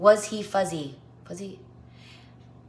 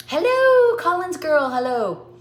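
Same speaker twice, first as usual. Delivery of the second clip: bright greeting; 7 semitones higher, 5 dB louder, slower than usual. Real, not acted.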